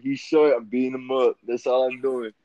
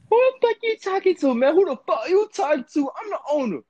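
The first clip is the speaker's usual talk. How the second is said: much higher-pitched put-on squawk; indignant mock outburst. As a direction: squeal it in mock outrage, far above your normal pitch. Acting out an indignant confrontation.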